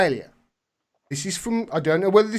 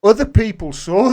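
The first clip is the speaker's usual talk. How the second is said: ordinary delivery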